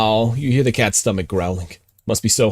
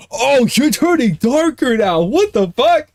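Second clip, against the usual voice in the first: very high, slow, amazed exclaim; high drawn-out disbelief; stunned surprise; 4 dB louder, much slower than usual. Real, not acted. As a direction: gape at the screen. Reacting to screen turning dark.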